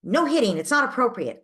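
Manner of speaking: hammed-up strict parent laying down the rule; stern no hitting. Shown as a demonstration. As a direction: bark the rule at the child. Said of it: "You're not just stating".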